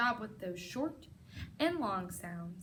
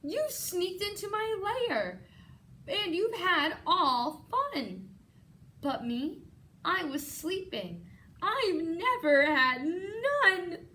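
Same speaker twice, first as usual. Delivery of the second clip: high, complaining, on the edge of tears; a sulky big bear feeling left out; whiny bear; 7 semitones higher, 4 dB louder than usual. Acted. Bear whining to the partying animals, tearful. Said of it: "And he whimpers".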